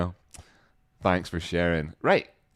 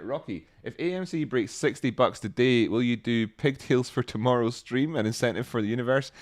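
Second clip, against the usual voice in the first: usual speaking voice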